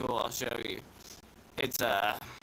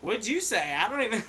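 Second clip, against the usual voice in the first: mock confused Northerner, pitched higher; higher, puzzled imitation; baffled Northerner; 7 semitones higher than usual. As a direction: imitate someone who can't follow the accent. Imitating baffled Michiganders' reply.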